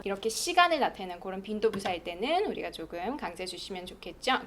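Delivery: normal talking voice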